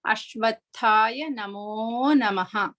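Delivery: Ashwatthaya Namo Namaha slowly, each syllable clear; careful pronunciation. Shown as a demonstration. Teacher models the last quarter for students, slow and careful. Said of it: "feel the air blow for the mahaprana".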